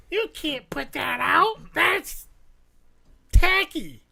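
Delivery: drawn-out, disapproving mother-in-law voice; scolding mother-in-law. Acted. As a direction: scold like a mother-in-law, rising on the last words. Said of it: "I got yelled at by my mother-in-law".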